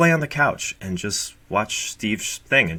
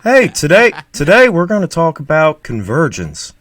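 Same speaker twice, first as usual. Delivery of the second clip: Steve impression; 5 semitones higher, 5 dB louder than usual. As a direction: ham up a Southern YouTuber explaining convergence. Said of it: "that's my Steve".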